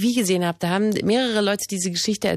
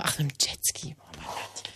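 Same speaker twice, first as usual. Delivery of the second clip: whispering; 7 semitones lower, 10 dB quieter than usual. Real, not acted. Embarrassed aside after missing it.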